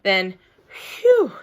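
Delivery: usual speaking voice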